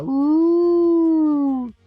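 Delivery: ordinary delivery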